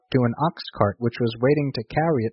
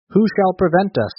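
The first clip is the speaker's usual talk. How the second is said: ordinary delivery, nothing marked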